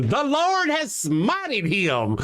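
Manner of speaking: slow, high, drawn-out pious gloat; mock pious theist. Streamer mocks theists gloating over his dropped internet, in a slow, high, smug voice. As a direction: stretch each word like a smug believer. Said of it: "watching the show and going".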